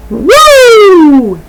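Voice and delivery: high, loud wrestler's woo cry; Ric Flair woo. Retold or imitated. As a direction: let out a big Flair-style woo.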